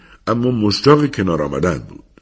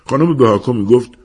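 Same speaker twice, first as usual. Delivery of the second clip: plain reading voice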